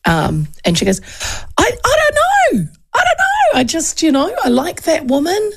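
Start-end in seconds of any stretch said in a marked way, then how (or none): 1.6-5.5 much higher, near-crying mock whine of an unsure girl; imitating a flustered young woman. Host mimics the hairdresser girl asking who to vote for, high teary whine. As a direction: play her as lost and teary, pitched way up.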